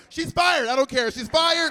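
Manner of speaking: emphatic firing. Acted. Mock presidential firing.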